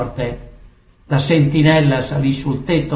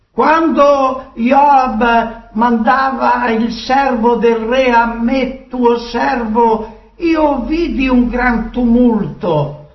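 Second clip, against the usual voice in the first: higher-pitched declaimed report; messenger reporting; 7 semitones higher than usual. Acted. Ahimaaz answers the king about the tumult, reporting formally. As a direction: declaim your account to the king.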